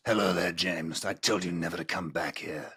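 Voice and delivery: low, soft husky menace; dramatic voice. Acted. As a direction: growl it like a ghostly host. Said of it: "I'm doing my dramatic voice".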